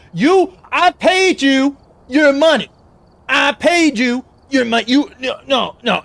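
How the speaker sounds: loud, high indignant yelling; angry shout. Acted. Yelling at the fake lawyer.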